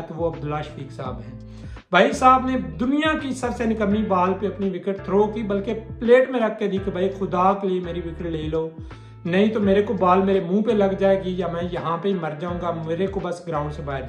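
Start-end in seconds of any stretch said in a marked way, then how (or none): none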